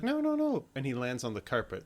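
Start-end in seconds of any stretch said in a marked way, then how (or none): 0.0-0.6 high-pitched retold protest; mock alarm. Retelling the princess as he jumps off, high mock alarm. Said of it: "she's like".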